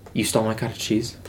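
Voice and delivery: low, quiet, flat disbelief; stunned and done with it; quiet incredulous line read; incredulous deadpan. Retold or imitated. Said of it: "So incredulous, so done".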